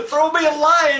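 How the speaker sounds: high, loud hammed-up cries for help; mock drowning cry. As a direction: wail for rescue, laughing. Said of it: "Help me, I'm drowning".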